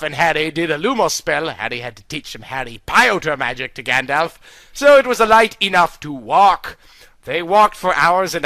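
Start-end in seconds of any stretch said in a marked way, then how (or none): none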